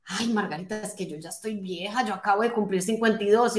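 a hint of a woman fretting over her age; mock complaining friend. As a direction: sigh it out like someone who thinks they're old. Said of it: "hay gente que me dice".

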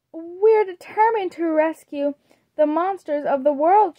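lightly voiced eager vampire girl, sincere; earnest Draculaura. A narrator's reading. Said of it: "she said earnestly".

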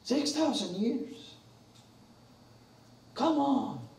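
high, slow, derisive disbelief; slow high mock incredulity; scoffing. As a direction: ridicule the number. Mocking young-earth claim.

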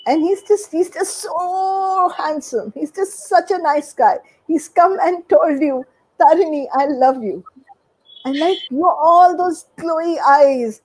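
high, dreamy swoon over a handsome man; lovestruck swooning. Retold or imitated. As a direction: gush about him like a smitten teen.

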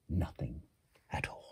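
whispered reveal; hushed punchline. A narrator's reading. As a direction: whisper the answer. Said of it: "can you guess what he said".

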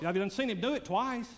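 normal talking voice

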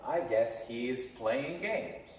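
slow teaching example, lifted at the end; slow model sentence. Shown as a demonstration. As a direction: model it slowly for learners. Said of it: "You can say".

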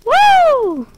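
high, far-off-sounding whoop; excited whoop. Real, not acted. Gamer cheering the goat's jump, high whoop.

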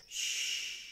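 breathy shushing whisper; hush. A narrator's reading. Grandfather hushing the others, whispered shh. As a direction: shush them quietly. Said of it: "until Adam says".

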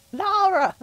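very loud, high yell of a name; scolding shout. Real, not acted. Player telling off Lara for yelling, exasperated shout.

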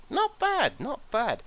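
playful high-voiced approval; mock squeaky. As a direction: pipe it up in a funny squeak. Smug about the popularity figure.

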